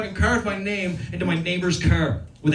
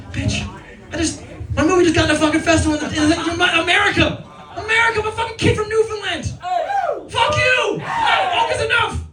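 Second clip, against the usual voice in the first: high yelling tirade; angry rant; 11 semitones higher than usual. Retold or imitated. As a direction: blow up at the nagging partner. Angry retort to partner.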